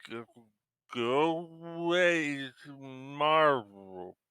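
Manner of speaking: slow, high, struggling raspy voice; raspy creature voice. Acted. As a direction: rasp the words out like something half-human. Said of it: "you hear a rather raspy voice that can't quite seem to form words well".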